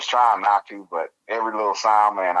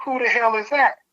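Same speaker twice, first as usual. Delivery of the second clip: very high-pitched, incredulous question; baffled disbelief. Retold or imitated. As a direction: act out total bewilderment, voice shooting up. Retold bafflement at unknown name.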